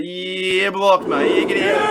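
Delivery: high, loud sing-song taunt at the blocked troll; gleeful gloating. Real, not acted. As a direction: sing him out the door, triumphant. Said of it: "Man, I haven't done that for a long time".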